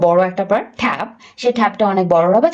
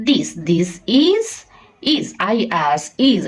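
slow reading demo; 4 semitones lower, much slower than usual. Shown as a demonstration. Teacher modelling slow reading of a sentence for a child. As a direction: stretch "this is" out slowly. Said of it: "এটা যদি কয়েকবার সে পড়ে".

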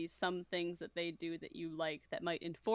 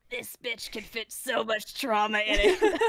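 slow, hammed-up salesman pitch building louder; in-character advert; 4 semitones higher, 11 dB louder, slower than usual. Player advertising own character's trauma, slow and loud mock pitch. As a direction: drag the words out, swelling to a loud laughing boast. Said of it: "advertise rolling with difficulty in character".

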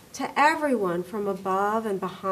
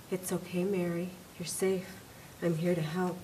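soft, low, reassuring words; gently calm a frightened patient; soothing caregiver; 4 semitones lower, 11 dB quieter than usual. Retold or imitated. The nurse reassures Mary, soft and tender.

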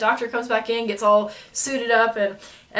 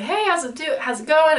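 cheerful doctor; 6 semitones higher than usual. Retold or imitated.

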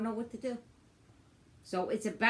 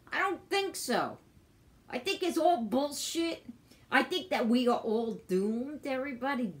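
angry rant; 6 semitones higher, 4 dB louder than usual. Real, not acted. Ranting about the cops.

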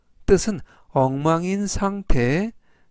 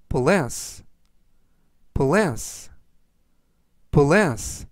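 slow word reading; much slower than usual. Shown as a demonstration. Teacher reading words for learners to follow, slowly. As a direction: bless read slowly and deliberately. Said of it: "제가 천천히 세 번씩 읽어드리고요".

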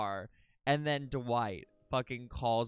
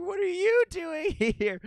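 high, loud, slow squeal with laughter; retold scream; 7 dB louder than usual. Streamer acting out spotting a friend in the next car, screaming. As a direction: shriek the question in laughing disbelief. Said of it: "I'm, like, screaming".

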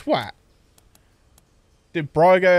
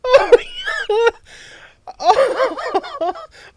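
shrieking laugh; 4 dB louder than usual. Real, not acted. Bursts out laughing.

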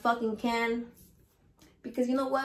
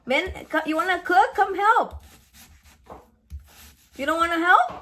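louder, higher call across the room; 7 semitones higher, 5 dB louder than usual. Real, not acted. To Mario across the kitchen: loud higher call to come help.

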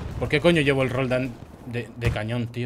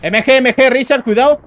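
loud, higher urgent warning shout; 7 semitones higher, 5 dB louder than usual. Real, not acted. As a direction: call out the danger sharply. Warning teammate of enemy.

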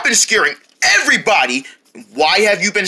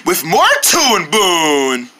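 usual speaking voice

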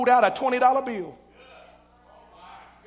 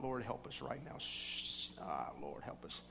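near-whispered, much softer plea to God; hushed prayer; 10 semitones lower, 14 dB quieter, slower than usual. Retold or imitated. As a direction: beg quietly so no one at the table hears. Hushed prayer, head ducked.

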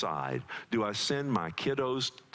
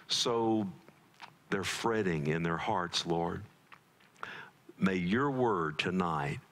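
lower, measured prayer voice, unhurried; 4 semitones lower than usual. Acted. Pastor praying for stressed families before the sermon, low earnest prayer.

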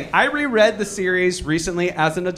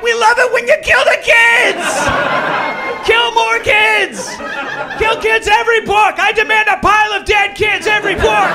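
very high, loud frenzied shouting; bloodthirsty fans; 5 dB louder than usual. Acted. Readers demanding the author kill more kids, high crazed shout. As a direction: demand it like a screaming mob. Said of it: "And then we're like".

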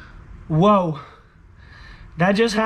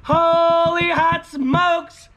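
excited shouting; 6 semitones higher, much slower than usual. Real, not acted. Celebrating again.

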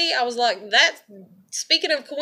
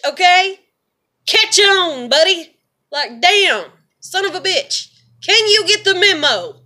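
loud, higher angry outburst; real anger; 5 semitones higher, 7 dB louder than usual. Real, not acted. Vlogger yelling at people who ignore her, furious. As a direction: hammer it home furiously. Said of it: "I'm really mad".

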